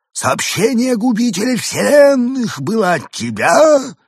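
high, slow alien question. A narrator's reading. Alien Evgeny asks. (высокий медленный голос пришельца)

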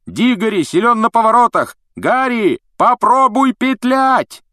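high, loud yell down the corridor; shouting after him. A narrator's reading. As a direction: call out loudly and urgently. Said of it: "кричал ему вдогонку".

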